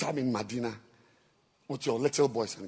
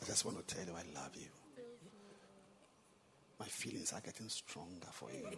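much softer, gentle loving lines; small-voice romance; 2 semitones lower, 21 dB quieter than usual. Shown as a demonstration. Preacher showing the congregation how love sounds, soft and tender. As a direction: murmur sweet nothings very softly. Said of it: "Love is expressed in a small voice".

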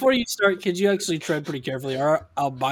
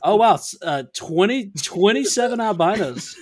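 louder, pitched-up pleasant surprise; mock amazement; 3 semitones higher, 3 dB louder than usual. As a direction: act impressed by an unexpected number.

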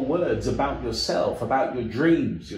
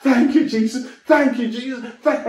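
much higher and louder, shouted thanks; crying out; 10 semitones higher, 6 dB louder than usual. Retold or imitated. Healed man shouting thanks to Jesus, loud crying out. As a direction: burst out with joyful shouting thanks. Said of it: "soon he was crying out".

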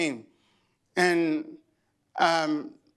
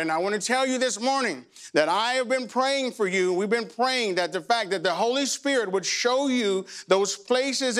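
raised, higher preaching voice building up; 6 semitones higher than usual. Acted. Pastor urging the congregation toward freedom, preaching higher.

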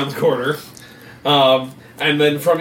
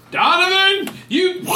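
high mock call; 13 semitones higher, slower than usual. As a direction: squeak the name out high. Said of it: "as you turn around and go".

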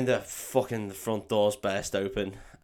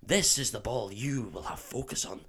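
soft put-on Scottish accent; Oliver Wood; 8 dB quieter than usual. Quidditch keeper teaching Harry, hushed Scottish accent. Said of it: "for me to do a Scottish accent".

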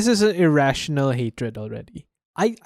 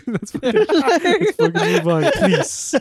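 laughing plea; 11 semitones higher, slower than usual. Real, not acted. Laughing, begging to change topic.

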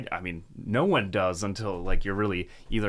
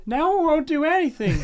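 pitched way up, whiny client voice; high mock client whine; 5 dB louder than usual. Service tech mocking a confused customer, high whiny voice. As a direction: squeak it like a baffled customer. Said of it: "they're like".

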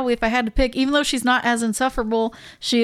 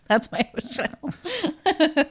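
laughing; 6 semitones higher, slower than usual. Real, not acted. Echoing with a laugh.

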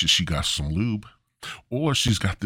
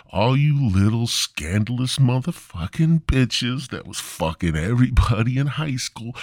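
ordinary talk

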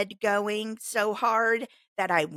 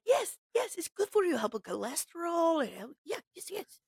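imitating the farmer; 8 semitones higher than usual. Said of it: "And he's like".

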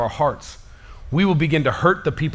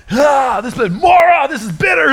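mock bitter complaint; 10 semitones higher, 5 dB louder than usual. Preacher acting out Israelites at bitter water, mock outrage. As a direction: whine like a grumbling Israelite.

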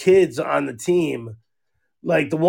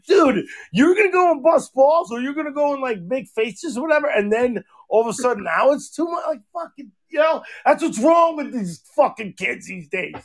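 much higher, shouting mock lecture; exasperated rant; 8 semitones higher than usual. Venting about kids who bullied his daughter, shouting. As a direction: blow up at the kids who started it.

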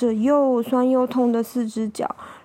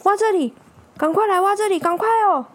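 higher, urgent, insistent little-dog voice; excited dog; 6 semitones higher, faster than usual. Acted. Dog urges old man to dig here, excited and insistent. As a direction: urge them on eagerly, over and over. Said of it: "啊，他说".

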